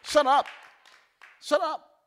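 loud, building rebuke; telling off the devil. Pretend. Preacher telling the devil to shut up, loud. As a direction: shout it down, each one bigger. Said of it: "Just say".

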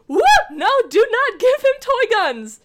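high, loud mock yell of a strict mom; Leia yelling. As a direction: shout it like a mom forbidding toy guns. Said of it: "and Leia was like".

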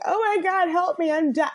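half-hearted imitation of panicked screaming; Carrie. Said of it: "running around screaming, like".

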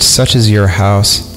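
ordinary talk

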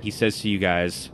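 normal talking voice

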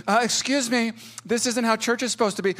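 high, mild-mannered mock objection; politely object, too meek to make trouble; Mister Rogers. Meek man objecting in church, high gentle imitation. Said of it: "Mister Rogers didn't walk in".

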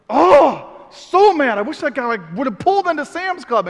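high, loud frustrated outburst; loud high mock fury; angry rant. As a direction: vent to your son. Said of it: "I'm like".

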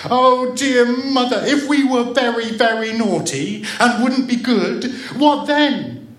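put-on high, loud tearful child voice; sobbing. A narrator's reading. Child asking mother about being naughty, sobbing. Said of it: "she sobbed".

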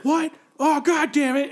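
high, loud retold outburst; exasperated surprise. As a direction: imitate a man caught out and annoyed. Retold moviegoer discovering his mistake.

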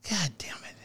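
very soft whisper under the breath; disgusted aside, whispered with no voice; whispered curse. Retold or imitated. Dad cursing his kid's Maui idea, whispered under his breath. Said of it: "Have you whispered it under your breath?".

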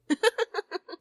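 light, high giggle; gentle acted titter; soft laugh. A narrator's reading. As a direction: laugh softly as an amused mother. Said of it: "Mama Peters laughed softly".

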